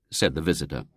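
usual speaking voice

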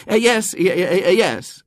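high, stammering fluster; harassed, anxious man. A narrator's reading. Tall man answering Eglantine, flustered. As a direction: stammer agreement, only half listening. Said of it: "He wore a harassed and anxious expression".

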